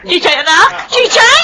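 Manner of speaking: high, rising panicked calls; frantic calling. Acted. Mother calling her limp baby's name, frantic. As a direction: call out to wake the child.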